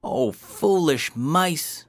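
high, drawn-out, scolding cry; very angry. Acted. King to his hesitant mice, angry. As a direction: cry out slowly in exasperation, pitched high. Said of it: "made the king very angry".

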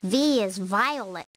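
plain reading voice

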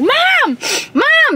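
calling Mom in a retold panic; panicked calling.

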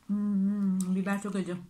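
talking while chewing pepper; speaking around food; mouth full, chewing. Real, not acted. Seen 2: Left woman bit pepper earlier, still chewing while commenting on it.

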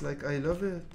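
normal talking voice

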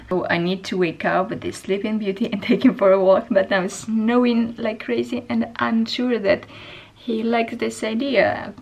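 ordinary talk, nothing marked

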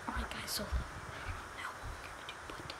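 sneaky unvoiced whisper to viewers. Real, not acted. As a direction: whisper the plan so nobody hears. Sneaking up in game.

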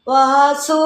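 a stricken father's long, mildly slurred call. A narrator's reading. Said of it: "அந்த சத்தம் குழறலாய் கேட்டது".

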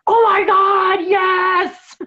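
loud, high, drawn-out gush of delight; thrilled shout; excited outburst. Retold or imitated. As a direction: blurt it out like you just read the best post ever. I was just like.

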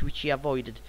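normal talking voice

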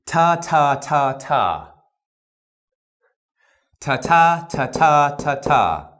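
loud, crisp "ta" syllables; ta drill. Shown as a demonstration. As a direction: tap "ta" hard and clean each time. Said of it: "We're doing T's".

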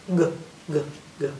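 hard "g" made at the back of the throat; short, separate hard "g" sounds. Shown as a demonstration. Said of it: "The hard sound is in the back of your throat".

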